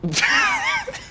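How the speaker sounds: sudden shrieking laugh; burst of laughter. Real, not acted. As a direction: explode into a shocked laugh. Host bursts out at the insult.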